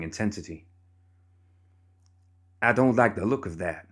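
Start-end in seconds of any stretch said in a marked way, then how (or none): none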